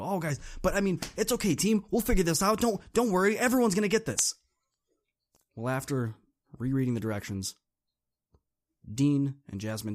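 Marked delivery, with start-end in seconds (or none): 0.0-4.3 high, louder cheerleading; rally the team, bubbly; excited. Retold or imitated. You reassuring the team with higher, louder excitement. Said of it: "all excited and go".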